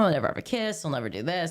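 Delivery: ordinary talk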